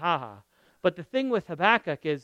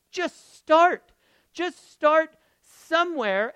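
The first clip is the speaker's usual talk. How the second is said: loud, high, drawn-out plea; urgent exhortation; 9 semitones higher, 7 dB louder, slower than usual. Real, not acted. Preacher urging the congregation to start, loud. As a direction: urge them to just begin. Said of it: "urging, loud high".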